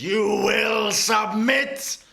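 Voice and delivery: roaring command, high and slow; screaming villain. Acted. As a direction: bellow it like a furious storm lord. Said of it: "he screams into the".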